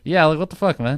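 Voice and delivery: loud high what the fuck; exasperated. Retold or imitated. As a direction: echo the outrage. Echoing the rant.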